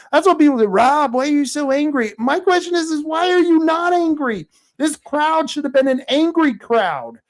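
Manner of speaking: fast, loud, high-pitched indignation; angry. Real, not acted. Host scolding viewers for not being angry, loud and indignant. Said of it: "because I'm angry".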